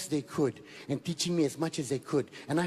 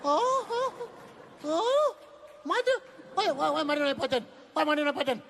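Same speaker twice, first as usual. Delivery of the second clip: put-on shocked child; 11 semitones higher than usual. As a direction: squeal it out, a wounded little boy. Child's shocked outrage retold.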